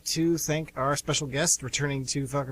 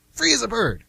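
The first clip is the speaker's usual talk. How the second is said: triumphant; 9 semitones higher, 6 dB louder than usual. Acted. Triumphant dream of freedom.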